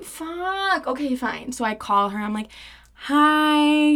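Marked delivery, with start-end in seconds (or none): 0.0-1.5 reluctant giving in. Retold or imitated. Acted reluctant giving in to friends. 3.1-4.0 high, drawn-out hello; put-on phone greeting. Acted phone greeting to ex's mom.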